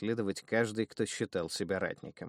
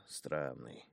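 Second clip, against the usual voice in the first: Странный much softer and lower, trailing; muttered; 7 semitones lower, 10 dB quieter than usual. Acted. Thug muses about the boy, Странный muttered low and soft. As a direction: murmur Странный to himself. Repeated word, different takes.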